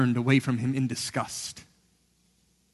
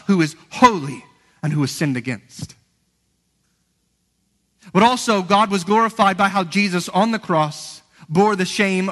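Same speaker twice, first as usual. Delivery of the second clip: higher, louder impassioned preaching; fervent pulpit exhortation; full-force preaching; 6 semitones higher, 5 dB louder than usual. Acted. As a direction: drive the point home from the pulpit.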